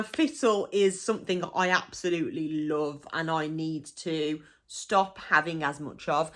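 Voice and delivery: usual speaking voice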